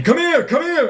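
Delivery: high, urgent calling shout; screaming for someone. Acted. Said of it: "I'm gonna scream for the woman".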